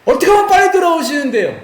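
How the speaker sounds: high-pitched acted question; eager questioner. Priests asking God how He returns sooner, acted high plea. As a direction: ask as an impatient petitioner, played up. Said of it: "이렇게 물어보거든요".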